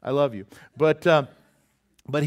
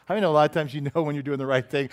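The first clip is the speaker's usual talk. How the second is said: ordinary talk, nothing marked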